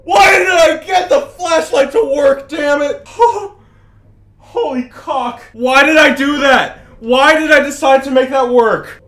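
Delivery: high loud panicked yelling; panicked laughing shout. Real, not acted. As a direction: shriek it, laughing in fright. Scared by flashlight reveal.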